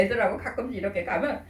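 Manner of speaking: usual speaking voice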